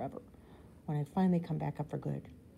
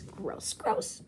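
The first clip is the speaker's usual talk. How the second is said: disgusted; 5 semitones higher than usual. A narrator's reading. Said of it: "she yelps".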